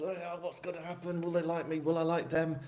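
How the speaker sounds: high-pitched first-day panic; nervous. Acted. New starter fretting before the first day at work, nervous. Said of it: "you're nervous".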